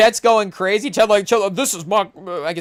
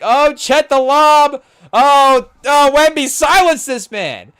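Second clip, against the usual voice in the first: much louder, higher, slow fan cry; excited play call; 9 semitones higher, 9 dB louder, slower than usual. Real, not acted. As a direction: shout at the screen for the block. Live play, urging Wemby.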